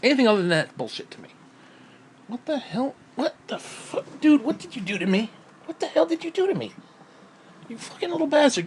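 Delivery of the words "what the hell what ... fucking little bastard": high, shocked, half-laughing at the dog; yelping what the hell at a playful dog; startled outburst at dog. Real, not acted. A man startled by his dog jumping on him, high shocked yelps.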